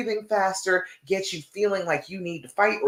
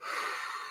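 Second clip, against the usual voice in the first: long demo exhale; 14 dB quieter than usual. Shown as a demonstration. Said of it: "I'm breathing".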